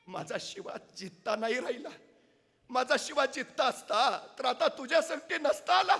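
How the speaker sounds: rising to high, loud lament; mother facing her son's death; grieving old mother. Acted. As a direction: her voice swells as she names his death. Mother explains her son died.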